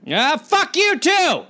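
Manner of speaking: high, slow indignant retort; mock offended. Official mishearing thanks over the crowd, mock offended. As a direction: call back as if insulted.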